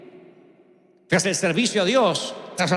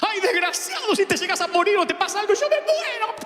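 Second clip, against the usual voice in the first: mother finding her lost son in the mall, scolding shrilly; frantic mother; 12 semitones higher than usual. Retold or imitated. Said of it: "Me pegaba".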